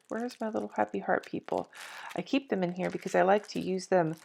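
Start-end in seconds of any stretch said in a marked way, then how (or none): none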